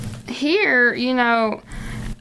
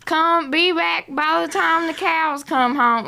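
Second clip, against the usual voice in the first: mocking the media's backwoods Southern twang; 7 semitones higher than usual. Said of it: "overplayed in the media".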